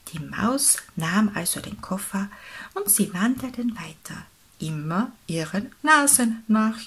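ordinary delivery, nothing marked

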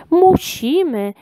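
high, drawn-out mock shout; gnome's cry. A narrator's reading. Gnome insists on being rude, high drawn-out cry. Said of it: "krzyknął jeden z krasnoludków".

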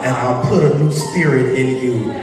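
building preaching voice. Acted. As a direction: preach it with rising fire. Reads scripture with rising force.

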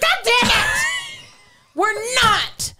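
exasperated mom. Acted. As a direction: blow up as the fed-up party mom. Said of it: "She was like".

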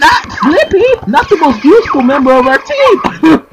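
high, hammed-up mock dismay; sarcastic. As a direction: wail it with fake horror for a useless teammate.

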